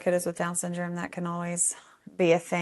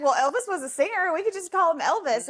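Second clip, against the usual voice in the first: much higher, matter-of-fact glee; playful volunteer; 10 semitones higher than usual. Retold or imitated. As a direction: defend the silly nickname cheerfully. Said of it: "he was like".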